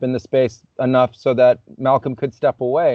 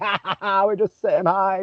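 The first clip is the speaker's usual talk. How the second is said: higher-pitched mock laughing voice; teasing friends; 6 semitones higher, 3 dB quieter than usual. Friends brushing off his question, mock-laughing playfully. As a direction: imitate giggly pranksters, pitched up.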